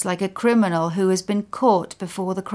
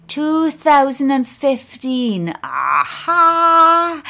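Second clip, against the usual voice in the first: high, loud, slow triumphant announcement; grinning declaration; 8 semitones higher, 7 dB louder, much slower than usual. A narrator's reading. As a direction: declare it with a grin, drawn out and victorious. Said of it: "then grinning declares".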